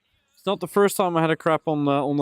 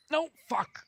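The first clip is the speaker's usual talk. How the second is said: high squeak; 12 semitones higher, 5 dB quieter than usual. Real, not acted. Fish escapes the hook.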